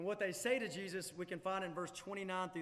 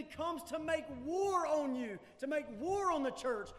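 preaching at full force; 6 semitones higher, 6 dB louder than usual. Real, not acted. Preacher warns the church of Satan's wrath, full-force preaching. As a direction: thunder the warning from the pulpit.

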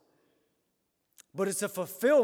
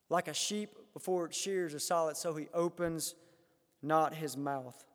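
hushed reading; 4 semitones lower, 7 dB quieter than usual. Real, not acted. Quiet reading of Isaiah.

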